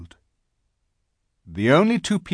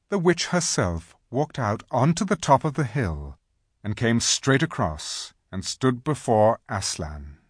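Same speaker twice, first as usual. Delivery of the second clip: plain reading voice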